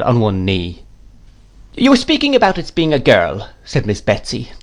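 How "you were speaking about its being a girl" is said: higher great-aunt voice; resume the subject firmly, pitched up; brisk aunt. A narrator's reading. Miss Betsy resumes about the girl, brisk.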